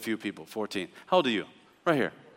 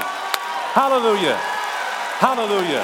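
drawn hallelujah; 3 semitones higher than usual. Real, not acted. Drawn out exclamations.

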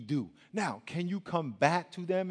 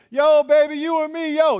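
loud, high catcall out the car window; yelling out the window; 9 semitones higher, 10 dB louder than usual. Retold or imitated. Friend catcalling an old lady from the car, loud high yell. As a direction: holler it at the lady like a jerk. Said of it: "yelling out the window".

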